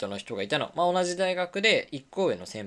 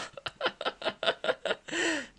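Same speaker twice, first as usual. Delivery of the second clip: breathy laugh; 6 dB quieter than usual. Real, not acted. Laughing at her own slip.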